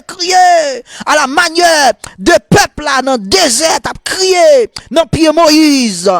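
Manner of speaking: high, shouted revival preaching; full-force exhortation. Real, not acted. Preacher urging people to cry like Bartimée, full-force exhortation.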